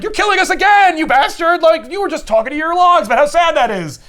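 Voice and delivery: high, loud mock outrage; Proteus as Mitchell. Proteus posing as Mitchell to Kirk, hammed-up outrage. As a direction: hurl a hammed-up accusation, pitched high. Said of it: "Proteus pretends to be Mitchell".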